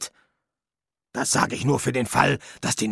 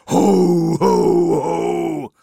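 booming Santa laugh, much louder, first ho higher; 3 semitones higher, 5 dB louder, much slower than usual. Pretend. Death overdoing the Santa laugh at every line. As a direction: tack on a big hearty ho ho ho. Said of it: "du brauchst nicht ständig".

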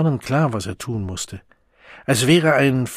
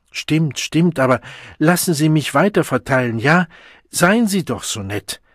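higher, coaxing and hurried; placating plea; 5 semitones higher, 5 dB louder than usual. A narrator's reading. Deputy bursar humours the Savage, pleading placatingly. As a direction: humour them and wheedle. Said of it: "lächelte beschwichtigend".

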